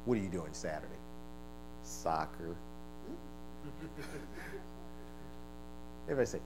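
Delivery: low, soft mock small talk; preacher mimicking bland suburbanites; mocking suburban chat. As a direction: tease the suburban lifestyle quietly.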